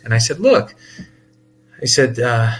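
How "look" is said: a high, loud "Look" to cut her off; sharp look. Retold or imitated. Telling the angry caller off with a high, loud look. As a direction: snap back to stop her. Retold heated phone argument.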